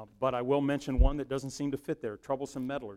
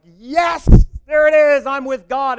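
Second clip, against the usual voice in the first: loud, high mock-triumphant shout; boisterous; 11 semitones higher, 22 dB louder, slower than usual. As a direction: burst out in exaggerated boisterous triumph. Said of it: "It is not often this boisterous".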